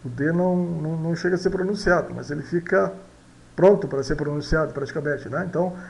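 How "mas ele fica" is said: ordinary delivery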